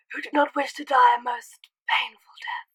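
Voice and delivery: put-on whispered ominous old headmaster; hushed grave wizard warning; Dumbledore impression. Vlogger finishes the Dumbledore line in a whisper. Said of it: "banging Dumbledore impression".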